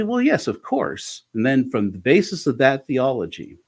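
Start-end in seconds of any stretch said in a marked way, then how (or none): 0.0-1.2 eager clients. Retold or imitated. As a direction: voice jumped high, chirpy and eager. Clients answering the therapist.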